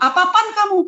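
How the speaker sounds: high, sharp retort; scolding father. Retold or imitated. Father coming out of his room, scolding. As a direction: demand it like an irritated father.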